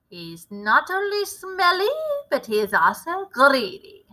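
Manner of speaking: a lightly voiced commanding witch leader; a hint of a grand witch showing off her recipe; Grand High Witch. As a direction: lecture the coven like their boss.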